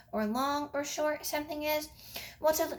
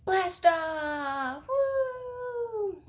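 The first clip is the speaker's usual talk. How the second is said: excited; 7 semitones higher, much slower than usual. Acted. Rocket countdown ends.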